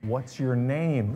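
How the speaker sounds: very soft, slow asking; hushed, drawn-out retold question; exaggeratedly soft question. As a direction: ask it gently and slowly, barely raising the voice. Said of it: "And so I said".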